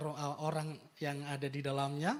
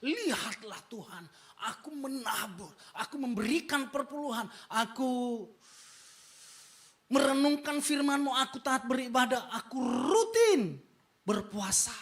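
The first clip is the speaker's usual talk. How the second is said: smug Pharisee listing his deeds, rising higher; a mock proud Pharisee, pitched up; boastful prayer; 7 semitones higher, 4 dB louder than usual. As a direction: brag to God, pleased with yourself. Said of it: "berdoa dengan kebanggaan dan kesombongannya".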